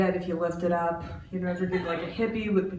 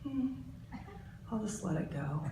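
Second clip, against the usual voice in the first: soft, much quieter resigned murmur; meek letting go; 13 dB quieter than usual. Retold or imitated. Old [name] meekly letting the line-cutter go, quiet. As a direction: swallow it and let it go. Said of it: "old [name] would have been like".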